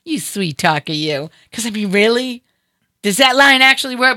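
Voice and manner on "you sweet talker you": low, soft mock-coy tease; joking flirt. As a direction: play at being charmed, dropping the voice. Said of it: "the comedian part of me".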